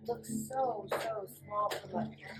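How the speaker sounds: hushed. A narrator's reading. Earth small from space.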